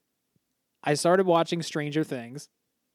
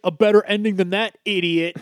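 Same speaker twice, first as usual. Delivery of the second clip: loud, higher scolding jab; mock critic; 5 semitones higher, 7 dB louder than usual. Man mocking a movie complainer, loud and scornful. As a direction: snap it at an imaginary critic.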